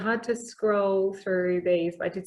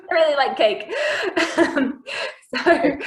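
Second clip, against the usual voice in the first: laughing, higher and louder; 8 semitones higher, 5 dB louder, slower than usual. Real, not acted. Host admitting her cake habit to the baker, laughing. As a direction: confess it through real laughter.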